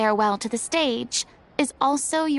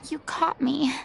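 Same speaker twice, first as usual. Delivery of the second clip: sheepish whisper. Acted. Furina whispers to the troupe, caught out sheepishly. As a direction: whisper it, a little embarrassed.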